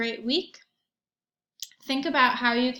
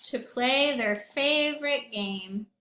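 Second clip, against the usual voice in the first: put-on lively read-aloud for young children; story narration. A narrator's reading.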